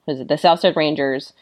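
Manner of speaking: ordinary talk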